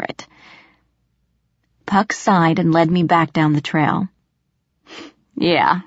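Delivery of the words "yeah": ordinary delivery, nothing marked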